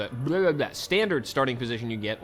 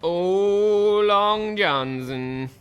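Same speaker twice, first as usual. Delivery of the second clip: talking cat impression; 8 semitones higher, 7 dB louder, much slower than usual. Mimics talking cat meme.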